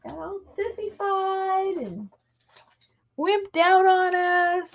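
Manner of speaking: high teasing voice to the dog; talking to the dog. Acted.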